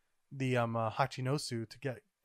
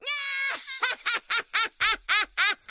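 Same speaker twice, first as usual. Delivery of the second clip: loud, high burst of laughter; high shrieking laugh; 4 dB louder than usual. Real, not acted. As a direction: crack up in disbelief. Laughing after shock.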